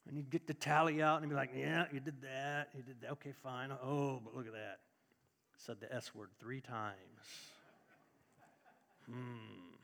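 a deep-voiced old God squinting at a sinner's tally sheet; God as judgmental old man. Preacher mocking a stern God judging a churchgoer, deep and grumbling. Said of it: "And he'd talk like this".

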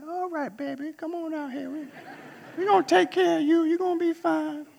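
high-pitched doting grandma voice; grandmother. Retold or imitated. Grandmother welcoming her grandson to Kalamazoo, high mock-grandma voice. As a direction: reassure in a high, put-on grandma voice.

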